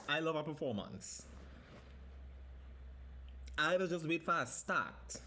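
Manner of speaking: ordinary delivery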